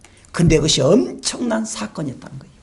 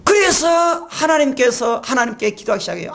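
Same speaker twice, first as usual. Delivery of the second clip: usual speaking voice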